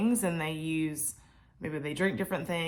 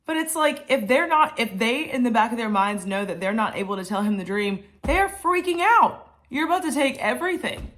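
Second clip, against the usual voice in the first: higher and louder, worked up and urgent; passionate outburst; 5 semitones higher, 5 dB louder than usual. Real, not acted. Preacher to her viewers, passionately worked up. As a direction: pour real passion into it, voice rising. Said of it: "Clearly, I was passionate about that part".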